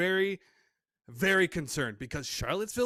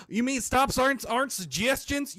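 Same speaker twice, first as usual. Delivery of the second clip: higher-pitched mock Southern accent; 6 semitones higher than usual. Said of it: "You put a Southern".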